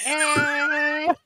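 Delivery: high goat scream. Acted. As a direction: let out a screaming goat cry. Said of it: "that screaming goat thing".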